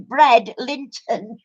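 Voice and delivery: "bread, Linton" said slowly as two separate words; split into two words. Shown as a demonstration. Showing a friend how the TV split the name, slowly. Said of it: "Two words".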